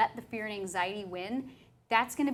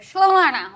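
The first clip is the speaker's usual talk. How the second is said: mock babble; 6 semitones higher, 13 dB louder, slower than usual. Imitating her own tongue-tied babble.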